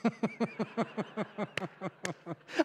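long, quiet chuckling laugh; real laugh. Real, not acted. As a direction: laugh at your own punchline. Laughs at his own joke.

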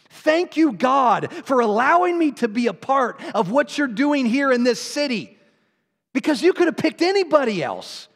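grateful cry of testimony to God; impassioned preaching. Real, not acted. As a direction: preach it out fervently, pitched high. Preaching at full force.

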